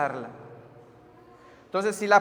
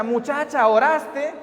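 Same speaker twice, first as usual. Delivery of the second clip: raised, louder questioning call; acted eager call; 5 semitones higher, 4 dB louder, slower than usual. Youth leader asking the girl if she prayed, excited call. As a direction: call out urgently, ending on a lifted question. Calls to girl across camp.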